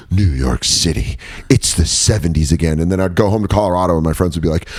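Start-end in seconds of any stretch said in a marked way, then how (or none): 0.1-2.8 news channel. Acted. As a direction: intone it like a grave news report. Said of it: "news channels were going like".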